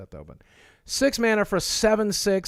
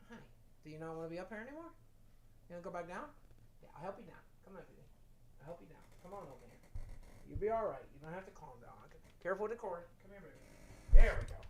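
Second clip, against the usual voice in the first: tender; 16 dB quieter, much faster than usual. Real, not acted. Talking to pet, away from mic.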